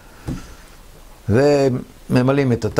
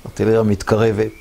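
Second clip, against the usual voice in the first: ordinary delivery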